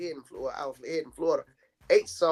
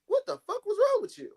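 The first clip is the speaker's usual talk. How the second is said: mock outraged, much higher voice; outraged quote. Aunt retelling her own outraged question about the niece. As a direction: toss out the question in higher disbelief.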